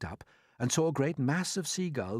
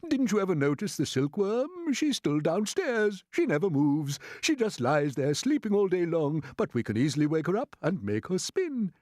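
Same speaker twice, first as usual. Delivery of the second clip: put-on old grasshopper; 8 semitones higher, 5 dB louder than usual. A narrator's reading. Grasshopper figure voice.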